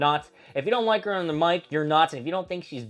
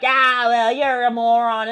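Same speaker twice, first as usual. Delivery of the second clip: mock Becky taunt; 6 semitones higher, 8 dB louder than usual. Becky insulting Punk.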